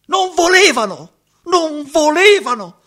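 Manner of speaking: shouting the refusal at the top of his voice; anguished shout. Acted. Telling the stranger how her parents forbade it, shouting. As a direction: cry it out, loud and high.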